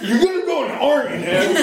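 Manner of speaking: high, fast, laughing; mock threat to himself, laughing. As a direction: tease it through a laugh. Mocking self-threat, laughing.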